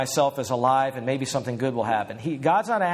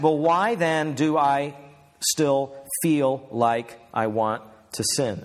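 slow questioning; slower than usual. Retold or imitated. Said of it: "You might ask".

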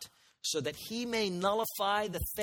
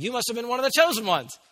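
higher, louder, quick delighted remark; surprised realization; 6 semitones higher, 5 dB louder, faster than usual. Retold or imitated. Preacher voicing the church's discovery, quick and delighted. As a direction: exclaim as if it just dawned. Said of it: "it's like".

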